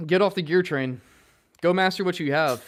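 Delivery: punchy reminder to stop buying gear; self advice. Real, not acted. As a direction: say it like a rule you preach to yourself. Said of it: "That's a lesson I have to tell myself".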